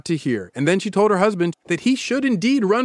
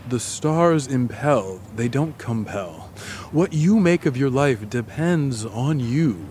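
calm; 5 semitones lower, much slower than usual. Acted. Astrologer's calm measured counsel.

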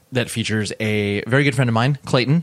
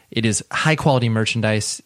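ordinary talk

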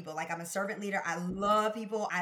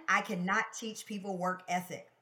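ordinary talk

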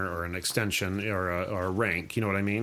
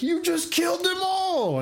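shocked shout. Retold or imitated. Cap yelling at Frank after he kills his comrades, high shocked shout. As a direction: yell it in disbelief at the killing. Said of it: "And Cap's like".

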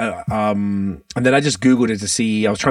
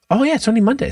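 sudden realization; 13 semitones higher, much faster than usual. Real, not acted. Realizes it's only Monday.

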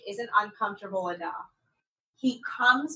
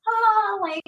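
flustered squeak; 12 semitones higher than usual. Retold or imitated. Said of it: "New York is like".

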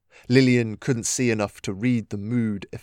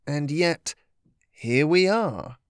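higher, slow sly taunt; sly teasing trickster spirit; 4 semitones higher, slower than usual. Acted. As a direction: purr it like a trickster. Impostor voiced, sly.

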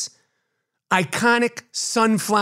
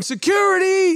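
onlookers crying out "Security!", much higher; mock panicked yell; 11 semitones higher than usual. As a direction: squeal it like a panicked museum visitor. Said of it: "onlookers were going".

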